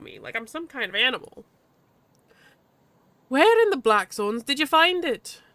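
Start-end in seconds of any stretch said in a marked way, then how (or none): 3.3-5.4 higher-pitched Irish-tinged badger; vaguely Irish accent. Badger sizes up the heater, vaguely Irish. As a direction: haggle in a loose Irish accent. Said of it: "the badger's vaguely Irish".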